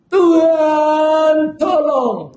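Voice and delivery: high, loud, pleading cry to God; desperate cry for help. Acted. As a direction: cry out as if drowning in trouble. People cry out in trouble. (berseru minta tolong dengan suara tinggi dan keras)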